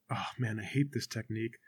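very low, soft grumbling; crowd murmur. Acted. Spectator complaining in the crowd, discontented murmur. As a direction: mutter it under your breath to a neighbour. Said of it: "the crowd starts to murmur".

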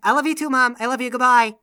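high, insistent phone goodbye; hurried loving son. Acted. Son ends a call with mom, embarrassed and eager.

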